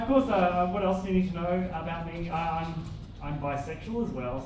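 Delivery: usual speaking voice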